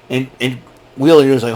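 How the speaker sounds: normal talking voice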